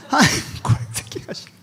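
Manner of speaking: high whispered prayer through laughter; mock prayer in tongues, laughing. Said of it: "방언으로 하세요".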